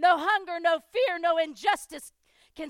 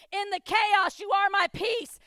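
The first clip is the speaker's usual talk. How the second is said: loud, impassioned recitation of song lyrics; rising preacher fervor; fervent preaching; 6 semitones higher, 5 dB louder than usual. Acted. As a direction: proclaim each line like a battle cry.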